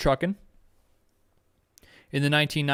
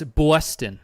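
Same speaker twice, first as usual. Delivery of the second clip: loud mock New York "Boston"; mock New York accent; 5 dB louder than usual. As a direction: say "Boston" like an exaggerated New Yorker, rounded vowel. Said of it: "Everyone always goes".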